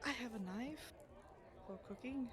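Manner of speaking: soft, small-voiced answer; meek admission. Oleander admitting a knife to the guards, meek and soft. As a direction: own up to it quietly.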